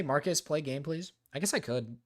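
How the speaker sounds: ordinary talk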